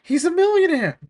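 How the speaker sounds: high, loud greedy squeal; hammed-up crook delight; greedy gawking. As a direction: gasp over the rich duck. Beagle Boys gawking at Scrooge.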